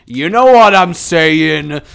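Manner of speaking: slow, loud, knowing drawl; suggestive. Pretend. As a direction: lean in and wink it at viewers. Drawn-out loud suggestive line.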